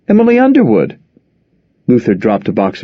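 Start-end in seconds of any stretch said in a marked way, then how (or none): 0.1-1.0 lightly voiced wife, higher; Nora's reply. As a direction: answer quickly, hoping he won't react. Wife's sheepish high answer.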